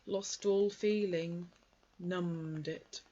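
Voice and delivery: mournful donkey martyrdom; gloomy Eeyore. Acted. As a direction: Eeyore moaning about his cold tail. Eeyore's voice held.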